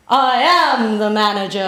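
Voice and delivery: loud, regal declaration; regal manager. Retold or imitated. Regal woman tells the job-seeker she is the manager, loud and proud. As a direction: announce it like she owns the place.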